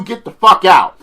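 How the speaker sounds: ordinary delivery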